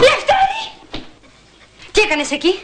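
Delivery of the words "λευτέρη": high, startled cry of his name; shocked cry. Acted. Wife walking in on her husband, shocked cry.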